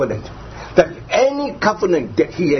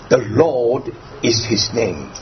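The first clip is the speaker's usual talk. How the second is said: "Lord" drawn out slowly, then a pause; slow, weighty stress on "Lord"; emphasis on Lord; 6 semitones lower, slower than usual. Shown as a demonstration. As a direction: lean slowly on "Lord" and let the rest settle. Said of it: "we read the emphasis".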